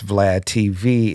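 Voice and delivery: ordinary talk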